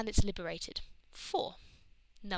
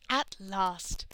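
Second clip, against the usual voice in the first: grand priestess proclamation, louder; proclaiming; 5 dB louder than usual. A narrator's reading. Cat priestess greets the three women, proclaiming. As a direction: proclaim it with ceremony. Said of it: "the cat priestess proclaimed".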